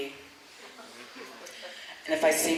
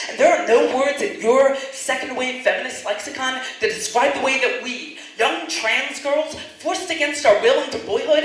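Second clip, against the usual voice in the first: defiant; 4 semitones higher, 6 dB louder than usual. Pretend. Wished-for retort to the woman.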